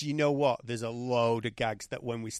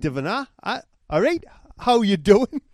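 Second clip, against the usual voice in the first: loud, high-pitched stab at Geordie; hammed-up Geordie greeting; attempted Geordie accent; 6 semitones higher, 10 dB louder than usual. Shown as a demonstration. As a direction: have a rough go at a Geordie accent. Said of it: "I want you to do a Geordie accent".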